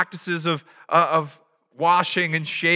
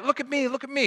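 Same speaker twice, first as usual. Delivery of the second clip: mock attention seeking; 8 semitones higher, faster than usual.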